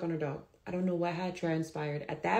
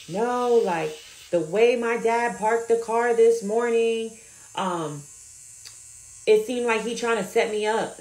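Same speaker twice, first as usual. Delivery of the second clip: retold phone argument, louder and higher; retold screaming; 3 semitones higher, 6 dB louder than usual. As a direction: imitate a client arguing loudly with her mom. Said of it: "But she's screaming".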